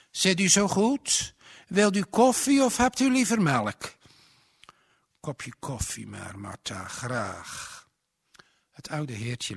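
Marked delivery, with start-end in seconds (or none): 0.1-4.0 hoge, luide stem van iemand die koffie aanbiedt; gedienstige huisgenote. A narrator's reading. Martha biedt de oude man koffie aan. 5.2-7.9 lage, zachte stem van een oude man; oud heertje. A narrator's reading. Het oude heertje antwoordt Martha.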